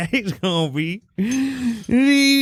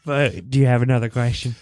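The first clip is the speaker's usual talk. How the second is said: deep voice. Acted. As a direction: drop into a low goofy voice. Same deep contestant voice.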